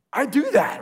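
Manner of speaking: put-on squeaky high, loud boast like a kid showing off; overexcited. Rich young ruler boasting to Jesus, pumped and high-pitched. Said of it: "way more excited than he should be".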